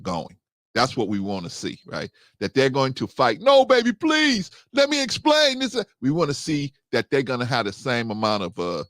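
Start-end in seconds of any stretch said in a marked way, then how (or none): 3.4-5.8 much higher, begging lover; mock pleading partner. Partner begging to keep the relationship, mock pleading. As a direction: plead like you're desperate to be forgiven.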